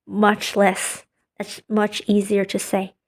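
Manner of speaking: usual speaking voice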